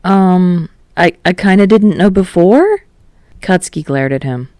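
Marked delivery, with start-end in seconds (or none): 0.1-2.8 sheepish. Acted. Kirishima sheepish, higher.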